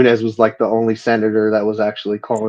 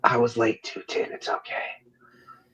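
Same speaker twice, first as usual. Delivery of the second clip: hushed whisper back; 11 dB quieter than usual. Pretend. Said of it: "He hit you back with the whisper voice".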